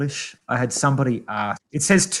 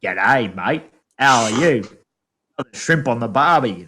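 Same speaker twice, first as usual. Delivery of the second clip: mock Australian accent; 3 dB louder than usual. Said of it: "They were expecting me to go".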